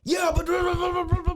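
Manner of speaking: high, babbling mock shouting; mocking rant. Man going off at the storyteller, mocked as babble. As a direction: imitate someone going off in gibberish. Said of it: "he just starts going off".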